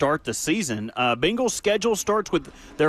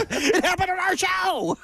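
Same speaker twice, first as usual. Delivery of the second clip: high-pitched mock-outraged yell; exaggerated squealed protest, mocking a co-host; George Costanza. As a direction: laughingly mimic an indignant outburst. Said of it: "closest you've ever been to George Costanza".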